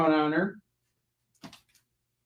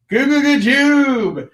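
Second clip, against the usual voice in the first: higher, louder, slow goofy chant of "Goo goo goo jube"; silly walrus voice; 7 semitones higher, 5 dB louder, slower than usual. Pretend. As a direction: drawl the nonsense like a dopey walrus. Walrus on cover thinking.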